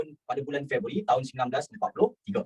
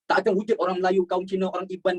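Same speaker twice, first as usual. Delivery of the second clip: normal talking voice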